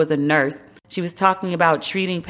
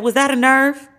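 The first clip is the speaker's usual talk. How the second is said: Richmond's cool jab, pitched up and punchy; pointed comeback; 7 semitones higher, 4 dB louder than usual. Retold or imitated. Congressman firing back at heckler, pointed and punchy. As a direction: land the one-liner with a knowing bite. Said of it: "he said".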